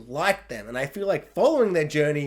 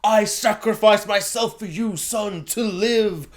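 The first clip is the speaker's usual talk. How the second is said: slow, hammed-up heroic last words; mock dying hero; 3 semitones higher, slower than usual. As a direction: play a melodramatic father bleeding out. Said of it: "he's bleeding out and he's like".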